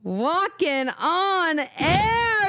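high, slow, dreamy and floaty; dreamy singsong. Real, not acted. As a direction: sing it out like you're floating. Dreamy sung-out catchphrase.

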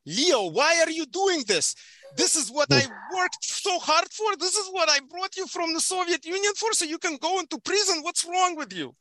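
mock the worried immigrant parents. Son tells how his parents scolded him, in a high mock-parent voice. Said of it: "they're like".

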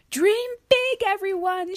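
high, loud rallying yell. Acted. Sheila yells to her friends at launch, high and loud. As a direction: shout it out to the crowd. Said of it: "she yelled".

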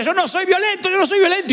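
high, heated denial; mock angry denial. Preacher imitates man breaking the desk, angry high denial. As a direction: insist angrily while smashing things. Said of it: "alguien decía".

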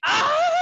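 high-pitched squeal; fangirl scream. Real, not acted. Co-host overwhelmed by an emotional line, squealing high.

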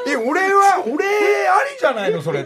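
loud, high whining protest; whiny shout. Retold or imitated. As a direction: cry out like a sulking kid. Acted plea, measures loud.

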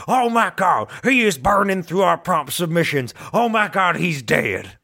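overwrought wrestling announcer; mock-horrified ringside commentary; J R impression. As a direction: call it like a stunned wrestling commentator. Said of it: "what's that J R is that wrestler announcer's name".

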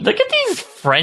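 high, slow teasing voice; playful high voice. Pretend. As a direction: tease in a squeaky voice. Mock French-lover voice.

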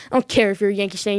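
usual speaking voice

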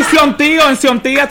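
Sion tío said high and loud as a Spanish greeting; Spanish blessing. Retold or imitated. Telling the hosts how he greets young relatives. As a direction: greet an elder in Dominican Spanish. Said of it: "I say".

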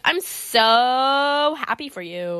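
drawn-out, fake-sweet congratulations; sarcastic. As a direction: stretch a long, insincere "so", mocking her. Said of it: "Why does she sound sarcastic?".

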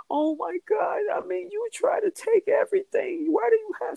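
high, whiny fake-teary voice; whiny crying brat. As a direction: whine it like a spoiled brat. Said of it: "sounded like a little whiny brat, full of tears, just crying".

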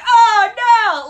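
very high, very loud mock "Oh, no!"; hammed-up frantic cry. Imhotep bursting in from off camera, hammed-up frantic cry. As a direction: overplay the panic like a cartoon.

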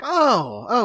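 high, loud drawn-out oh; exaggerated dawning acceptance; mock realization. Retelling Billy believing the excuse, mock realization. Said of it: "And Billy's like".

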